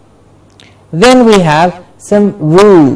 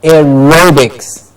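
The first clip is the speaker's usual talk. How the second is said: "Aerobics" slowly, air-ROH-biks; slow model of "Aerobics", beat on "ro"; stress on RO; slower than usual. Shown as a demonstration. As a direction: draw out "ro" in "Aerobics". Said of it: "Ro gets the stress".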